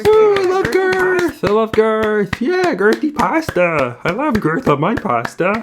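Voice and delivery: high excited Kermit voice. Acted. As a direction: squeal like Kermit the Frog. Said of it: "You became half Kermit".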